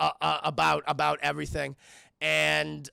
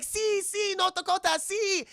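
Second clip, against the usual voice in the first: put-on high girl voice; 12 semitones higher than usual. Voicing little girl.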